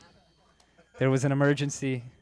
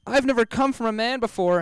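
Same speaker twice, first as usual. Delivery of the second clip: high mock girlfriend voice; 10 semitones higher, 5 dB louder than usual. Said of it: "so she said".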